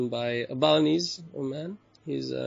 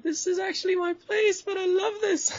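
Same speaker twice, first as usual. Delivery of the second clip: much higher, grinning reveal; delighted reveal; 4 dB louder than usual. Retold or imitated. Owner revealing himself to guests, a much higher, gleeful reveal. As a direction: let the joke land with glee.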